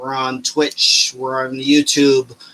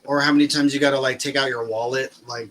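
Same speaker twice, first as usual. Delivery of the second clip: usual speaking voice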